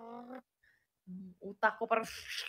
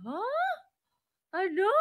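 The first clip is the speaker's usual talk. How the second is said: baffled huh; 8 semitones higher than usual. Retold or imitated. Imitating her friend not getting the question, baffled. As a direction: mimic a startled rising huh. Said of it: "Ganun talaga siya, parang".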